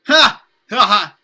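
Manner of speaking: loud, high acted "ha" bursts; hammed-up burst of spoken laughter; mocking laughter. A narrator's reading. Group of boys jeers at Matt, acted laughing. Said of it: "burst into laughter".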